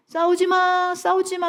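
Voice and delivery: high, drawn-out child's plea, acted out; tearful pleading. Daughter begging her parents to stop fighting, tearful pleading. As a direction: imitate a daughter begging through tears. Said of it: "울면서".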